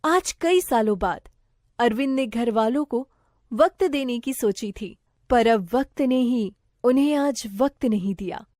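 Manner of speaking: usual speaking voice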